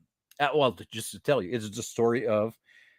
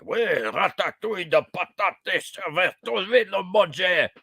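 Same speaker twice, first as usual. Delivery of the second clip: higher-pitched, garbled mock French of non-native actors; mock bad French accent; 6 semitones higher than usual. Vlogger mocking actors supposed to be French, mock bad French accent. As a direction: imitate actors badly faking French. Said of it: "supposed to be French and were talking like".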